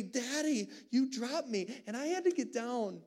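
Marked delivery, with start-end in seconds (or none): 0.0-1.8 put-on child asking. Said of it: "and he asked me".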